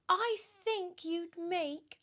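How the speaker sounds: very high, sneering witchy voice; high mocking hag voice; spiteful Aunt Spiker. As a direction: jab at her sister with a high sneer.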